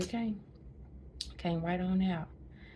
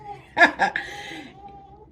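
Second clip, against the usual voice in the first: loud laugh; 9 dB louder than usual. Real, not acted. Laughing at nurse's remark.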